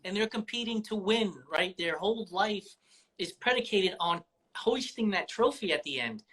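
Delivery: impassioned fan, pitched well up; passionate. Real, not acted. Basketball fan defending women athletes' smack talk, passionately. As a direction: argue it with real passion, voice riding high. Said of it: "Clearly, I'm passionate about this".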